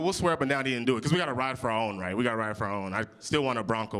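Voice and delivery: usual speaking voice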